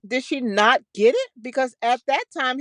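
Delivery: usual speaking voice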